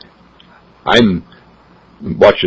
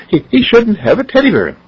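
higher, fretting mother voice; worried mother pleading; 13 semitones higher than usual. Acted. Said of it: "mother objects".

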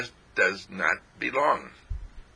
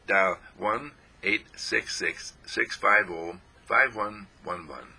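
slow phone number; slower than usual. Real, not acted. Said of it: "reading phone number slowly".

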